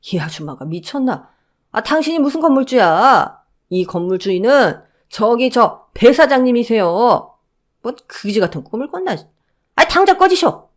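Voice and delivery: mock her then chase her off; guard driving out the mother-in-law; scornful guard.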